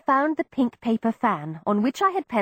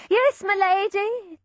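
high, slow obedient repeat; slow fading yes; servant; 10 semitones higher, slower than usual. A narrator's reading. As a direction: drag out the reply as he goes.

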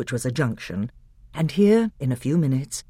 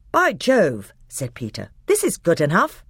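higher, louder delighted exclaim; delighted boy; 4 semitones higher, 4 dB louder than usual. Acted. Peter exclaims with delight at the beach. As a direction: relish the view.

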